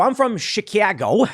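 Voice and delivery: hammed-up native Chicago accent. Shown as a demonstration. Chicago native introducing where he's from, Chicago accent. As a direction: say Chicago with a hard Chicago twang. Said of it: "a remnant of the Chicago accent".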